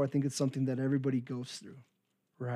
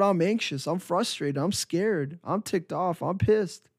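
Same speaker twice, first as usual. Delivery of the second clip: higher, strained plea to the Lord; anxious prayer; 5 semitones higher than usual. Retold or imitated. Praying to the Lord, anxious and frustrated. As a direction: pour out frustration to God. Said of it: "praying to God in distress".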